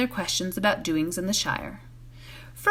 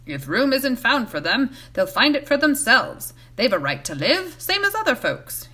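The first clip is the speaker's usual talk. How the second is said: loud traveller; 3 semitones higher, 3 dB louder than usual. A narrator's reading. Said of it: "he said loudly".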